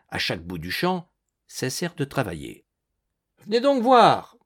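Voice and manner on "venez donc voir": high, loud call of surprise; surprised exclamation. A narrator's reading. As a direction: call the others over, astonished. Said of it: "poussa une exclamation de surprise".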